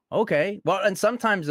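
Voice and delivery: high, loud bright okay; eager okay. Retold or imitated. An actor eagerly accepts a new character, high and loud. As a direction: jump at the job. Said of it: "someone throws a picture at you and you go".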